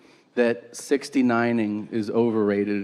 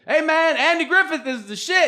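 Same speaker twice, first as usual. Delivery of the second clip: high, loud hype yell; aggressive shout; 5 dB louder than usual. Retold or imitated. Audience member hailing Bruce Bruce, high aggressive shout.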